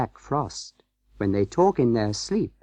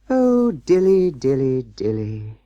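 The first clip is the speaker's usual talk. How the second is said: sighing; 4 dB louder, slower than usual. A narrator's reading. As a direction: sigh it out slowly, sinking in pitch and volume. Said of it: "sighed Simpkin".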